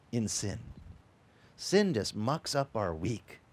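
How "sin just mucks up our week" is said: ordinary talk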